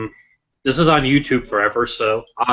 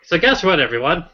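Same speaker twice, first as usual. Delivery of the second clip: usual speaking voice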